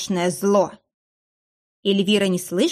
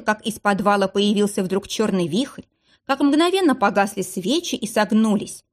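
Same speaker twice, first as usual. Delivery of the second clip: usual speaking voice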